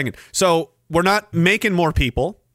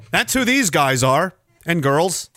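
normal talking voice

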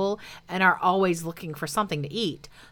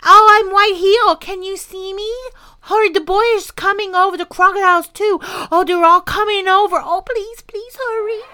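much higher, loud pleading dragon; desperate yelling; 13 semitones higher, 9 dB louder than usual. Acted. Dragon jumping up and down yelling for rescue, frantic.

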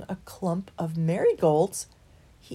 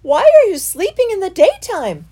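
loud; 13 semitones higher, 13 dB louder than usual. Acted. Christopher calls to sleeping hares.

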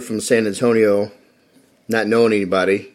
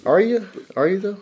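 teasing; 10 semitones higher than usual. Real, not acted. Teasing friend's glad to be here.

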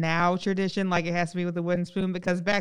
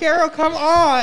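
calling out; 9 semitones higher, 6 dB louder, slower than usual. Pretend. Pleading with Carol.

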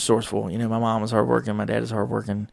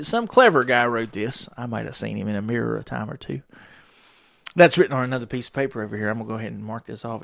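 ordinary talk

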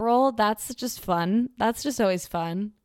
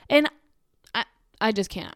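ordinary talk

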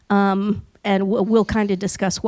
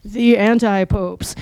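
echoey dramatic voice. Acted. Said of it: "I can't do the special effect the way Super Nerd does".

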